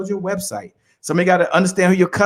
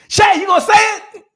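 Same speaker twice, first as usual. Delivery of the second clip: high, loud disbelieving shout; retold shouting, pitched up high; 7 dB louder than usual. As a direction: yell it half in shock. Retelling his shock at the chant.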